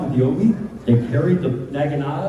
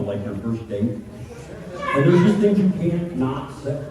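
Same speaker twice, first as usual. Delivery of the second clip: ordinary talk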